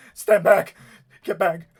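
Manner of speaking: sharp defensive shout; panicked warning shout. Acted. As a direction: shout them back in alarm. Man warns off puppet with device.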